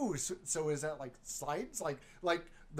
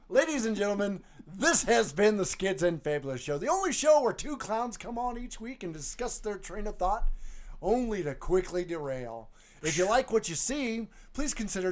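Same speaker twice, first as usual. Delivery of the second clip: hosting the show outro; show announcer; 4 semitones higher, 4 dB louder than usual. Acted. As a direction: announce it like a host.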